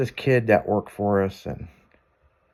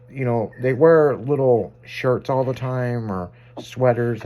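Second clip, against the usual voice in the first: ordinary delivery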